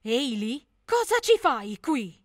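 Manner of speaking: loud, slow shout across the crowd. Acted. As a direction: call out to her, startled and loud. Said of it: "urlò". (grido forte e lento)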